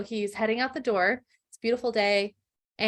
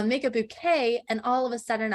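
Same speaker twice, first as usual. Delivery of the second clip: normal talking voice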